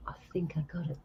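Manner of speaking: soft whisper to oneself. Real, not acted. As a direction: whisper it under the breath, tentatively. Unsure, to self while setting up.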